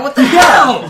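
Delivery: loud, high disbelieving yell; shocked outburst. Real, not acted. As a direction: blurt it out in disbelief. Reacting to special classes.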